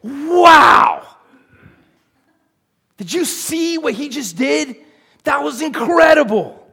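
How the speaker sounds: high, loud, gushing wow; mock amazement. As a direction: exclaim as if hugely impressed, hammed-up. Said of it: "looks down at your life and goes".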